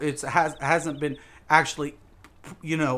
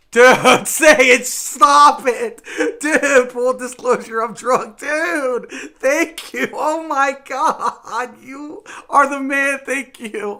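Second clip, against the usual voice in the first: very high, very loud shouts breaking into laughs and sobs; real ecstatic yelling at a donation; overjoyed shouting thanks; 10 semitones higher, 9 dB louder, slower than usual. Real, not acted. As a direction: scream your thanks, overwhelmed with joy. Streamer shouting thanks to donor.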